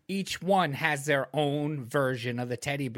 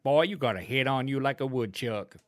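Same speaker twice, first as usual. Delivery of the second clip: imitating a cussing Southern granddad; Southern grandfather; 7 dB louder than usual. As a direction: scold in a hammed-up Southern accent. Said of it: "what my grandfather would say to my".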